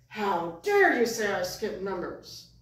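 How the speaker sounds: slow, loud offended puppet voice; mock outrage. Puppet accused of skipping numbers, hammed-up affronted outrage. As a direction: draw out the wounded indignation theatrically.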